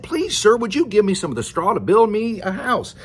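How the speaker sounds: lightly voiced pig asking a favour; pleading little pig. Pig begs a man for straw.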